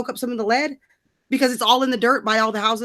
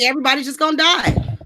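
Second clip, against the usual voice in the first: mock doom; 6 semitones higher, 4 dB louder than usual. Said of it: "I'm like".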